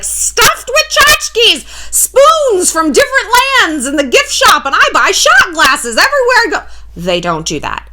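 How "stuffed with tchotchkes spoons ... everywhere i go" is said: high, drawn-out mock collector voice; gushing souvenir collector. Mom's friend describes tacky houses, mocking high-pitched gushing. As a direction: sing out the clutter in a loud high caricature.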